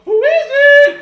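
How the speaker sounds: very high, very loud shout; high bratty yell; mock innocent shout. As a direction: yell it high and cheeky through the door. Kid answering dad through door.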